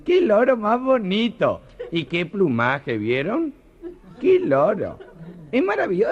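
high, slow, swooning lady's voice; gushing party lady. Acted. Lady guest gushing over the groom's parrot, high and slow.

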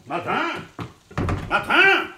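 high, loud call into an empty office. Acted. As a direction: shout for Martin through the building. Calling for missing gendarme.

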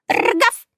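high, rolled dog woof; dog bark. Acted. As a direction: yap like a little dog. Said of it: "лаяла собака".

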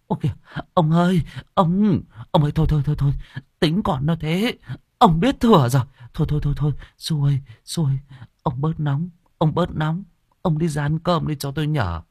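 high, hurried pleading; pleading wife. Acted. Wife begging her furious husband to calm down, high and pleading. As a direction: hold the husband back and calm him. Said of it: "vội vàng kéo ông lại mà gàn".

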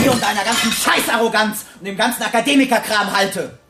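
furious rant. Acted. Gunman raging at the doctor, furious rant. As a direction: spit out the hatred.

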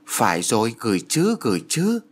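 rising into delighted eagerness; eager exclaim. A narrator's reading. Mother eager to send her daughter a gift, excited rising exclaim. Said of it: "Bà ta reo lên".

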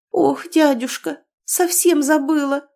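high, slow "Ох" of sudden recall; sudden exclamation. A narrator's reading. Niece interrupting uncle, mock sudden exclamation. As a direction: exclaim as if just remembering. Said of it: "вновь воскликнула".

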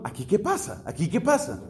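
retold puzzlement, asked under one's breath; puzzled wondering. Onlooker asking himself what is going on, puzzled. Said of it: "yo me sigo preguntando".

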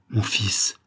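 stunned cry. A narrator's reading. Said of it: "s'écria le vieillard".

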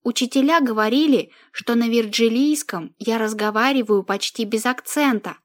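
usual speaking voice

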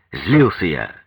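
ordinary delivery, nothing marked

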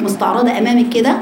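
ordinary talk

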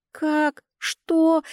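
a high yelping cry of disbelief; shrieking protest. A narrator's reading. Ganer caught out, shrieks at Ned in disbelief. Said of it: "завопил Ганер".

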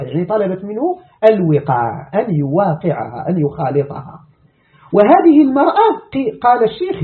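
ordinary talk, nothing marked

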